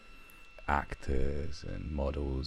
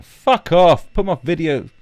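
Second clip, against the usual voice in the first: loud, high-pitched irate householder; mock angry yelling; 13 semitones higher, 12 dB louder than usual. Householder to a guest taking the player, mock outrage. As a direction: yell at a thief, hammed-up outrage. Acting out throwing out thieving guest.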